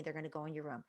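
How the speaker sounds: normal talking voice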